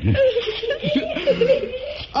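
shrill mocking cackle; cruel high laugh. Captor laughs at the woman.

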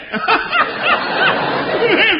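high wheezing cackle. Acted. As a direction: cackle at your own joke. Laughing fit.

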